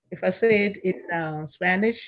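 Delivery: ordinary delivery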